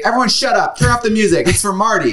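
high, bossy call to the room; commanding party host. Retold or imitated. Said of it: "You'd be like".

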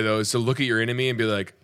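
ordinary delivery

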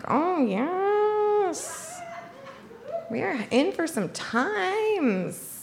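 long, swooping high purr, softly drawn out; relishing it slowly, softly, high and singsong; delighted moan. Retold or imitated. Teller replaying her pleasure with the new date, softly moaned delight.

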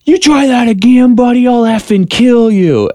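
mock the angry player's threat; furious threat. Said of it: "He's furious".

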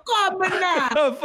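high, slow Caribbean psychic hotline call; mock Caribbean accent. Co-host echoing the host's hotline line, mock Caribbean accent. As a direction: draw it out in a playful Caribbean accent.

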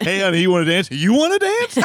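squeaky, high flirty "you wanna dance"; high stripper voice. Retold or imitated. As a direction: pitch way up, coy and teasing. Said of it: "She's like".

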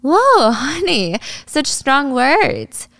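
high, exaggerated mock shock; patronizing swoop up high, slowing down; mocking condescension. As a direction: drag it out condescendingly. Rival mocks Ari's accusation.

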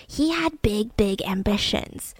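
hushed, breathy whisper. Real, not acted. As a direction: lean in and whisper it. Dramatic hushed emphasis.

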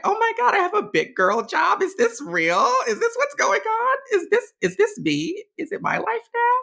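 high, loud reenacted squeal of disbelief; squealed questions, giddy and frantic; crying and squealing. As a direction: act out overwhelmed, tearful joy. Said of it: "crying and squealing because now I'm just like".

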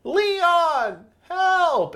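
falsetto whine for Leon; repeated high mock cry for help; high pleading cry. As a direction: shriek it up high, begging to be saved. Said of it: "and it was".

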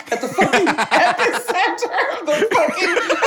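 real laughter breaking through the words; laughing. Real, not acted. Guest laughing at her own earthquake joke, cracking up.

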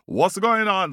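high, loud alarmed question; startled shout. Acted. Tiger woken by the banging, asking in alarm. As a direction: demand it in a startled yelp.

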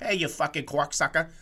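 mock Boston heckle with W-rounded vowels; Boston accent. Shown as a demonstration. Demonstrating Boston speech to co-host, exaggerated Boston accent. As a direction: exaggerate a Boston street insult. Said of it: "added a W to a lot of".

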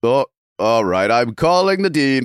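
lightly voiced old teacher threatening the dean; slow mock threat. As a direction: drag out a weary teacher's warning. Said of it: "he would go".

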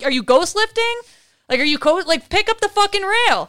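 loud, high mock yelling. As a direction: bark at a slacker. Retelling crew yelling at him.

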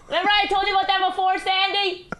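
high mock voice of a defensive Rich; mocking imitation. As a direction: parrot him in a silly high voice. Mocking Rich's defensive answers.

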